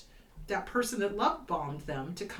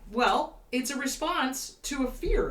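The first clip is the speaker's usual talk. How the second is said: ordinary delivery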